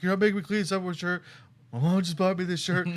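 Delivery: high, loud and fast mock outrage; emotional protest. Refusing to clean the car with her shirt, high loud protest. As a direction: whine it in teenage indignation. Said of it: "start getting super emotional".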